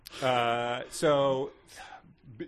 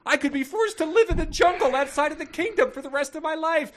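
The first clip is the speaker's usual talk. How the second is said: high, loud hammed-up lament; over-the-top desperate read; melodramatic outburst; 6 dB louder than usual. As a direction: wail it out as an overwrought plea. Hammed-up reading of the note.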